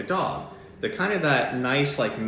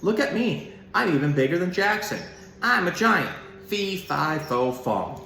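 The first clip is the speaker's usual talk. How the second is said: boastful shout; 6 semitones higher than usual. A narrator's reading. Carter Corey taunting from the fence, boastful shout. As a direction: show off from the fence top. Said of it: "he climbs the fence and shouts".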